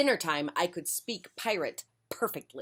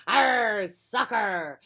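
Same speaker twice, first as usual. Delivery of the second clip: pirate yell; 4 semitones higher, 5 dB louder, much slower than usual. Acted. Pirate captain yelling.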